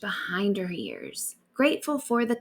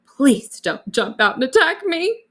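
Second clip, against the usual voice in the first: a touch of fear, raised and tense; nervous plea; 5 semitones higher, 8 dB louder than usual. Acted. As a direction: plead nervously with the hidden snake. Nervous plea to hidden lizard.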